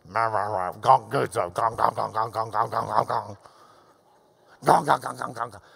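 low grumbling "gong, gong, gong", muttered under the breath; low murmuring. Shown as a demonstration. Preacher demonstrating the Greek word for murmuring, low grumble. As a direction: grumble it low like a complaining crowd. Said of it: "to murmur in a low voice".

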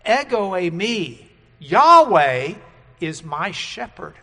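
"Yahweh" shouted loud and rising; proclaimed. Shown as a demonstration. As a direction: cry the name out, then settle. Second, proclaimed reading of the verse.

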